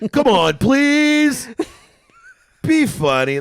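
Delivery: high, loud, slow mock plea; hammed-up begging. Mocking a message to comics, hammed-up begging. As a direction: beg in jest, stretching every word.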